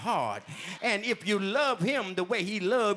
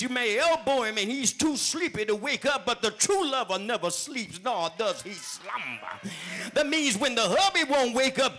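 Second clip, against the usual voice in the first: full-force preaching; 5 semitones higher than usual. Acted. Preacher to the sisterhood in the pews, preaching at full force. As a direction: preach it hard to the congregation.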